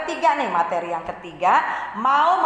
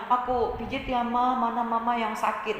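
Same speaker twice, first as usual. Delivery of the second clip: put-on gentle child; 3 semitones higher, 4 dB quieter than usual. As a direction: ask tenderly where it hurts. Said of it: "anak yang lemah lembut itu".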